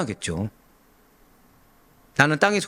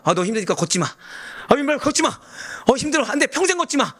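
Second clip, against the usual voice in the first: higher-pitched, mock-fussing parent; overprotective parent; 10 semitones higher than usual. Preacher voices a parent telling a toddler not to walk, mock fussing. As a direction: fuss over a toddler, pitch climbing.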